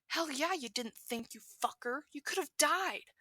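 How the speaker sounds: yelling. Acted. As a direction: scream at him, scared and angry. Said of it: "Katsuki is now yelling".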